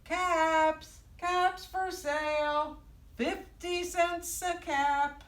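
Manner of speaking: high, drawn-out vendor cry; storybook hawker calling; peddler's street call. Acted. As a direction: call out wares slowly in a singsong. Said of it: "he walked back to town calling".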